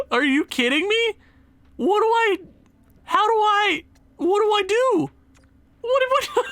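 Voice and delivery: high, frustrated pleading; what do I even do, throwing up your hands; exasperated. Real, not acted. Gamer pleading with the game over losses, exasperated high outburst.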